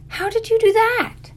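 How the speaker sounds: very high-pitched amazed question; astonished question. Acted. Girl hearing he sank a truck, astonished. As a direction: squeal it in disbelief.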